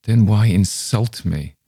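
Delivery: slow, bitter hurt retort. A narrator's reading. Paulina turning on the narrator, slow and bitter. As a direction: let the bitterness drag each word. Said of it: "She spoke very bitterly".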